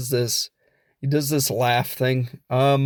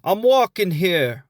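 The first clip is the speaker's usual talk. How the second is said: higher-pitched mock New Yorker; New York accent; 4 semitones higher than usual. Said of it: "a New York version of India".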